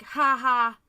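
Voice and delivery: loud spoken "ha ha", no real laughter; mocking imitation of a guy's laugh; mock texted laugh. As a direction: imitate his laugh as two punched words. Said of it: "And he's like".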